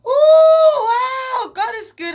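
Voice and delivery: very high, loud cry of joy; mom's joyful shriek. Retold or imitated. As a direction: shriek with delight at the news. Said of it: "She's like".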